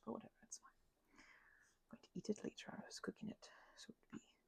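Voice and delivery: soft unvoiced ASMR whisper; hushed aside to the listener. Real, not acted. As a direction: breathe the words, no voice.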